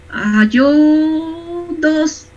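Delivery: lightly voiced discouraged student; mock dejected student. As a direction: stretch it out like a defeated teen. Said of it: "Ah, me dicen".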